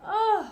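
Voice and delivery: loud, high groan of disgust; disgusted ugh. Real, not acted. Reacting to jealous Othello, loud disgusted groan. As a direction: groan it out in revulsion.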